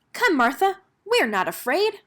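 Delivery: high, loud brave front; forced bravery. A narrator's reading. Girl urges her dog into the dark alley with forced courage. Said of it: "Finally, she said".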